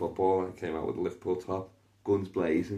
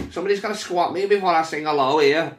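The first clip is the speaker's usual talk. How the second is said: [name] voice; 10 semitones higher, 8 dB louder than usual. Retold or imitated. [name] impression, mock diva.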